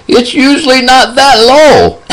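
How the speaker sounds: put-on very high, slow old man voice; squeaky old man, stretching the words out; little old man. Old patient telling his nurse about blood pressure, little old man voice. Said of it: "he talks like this".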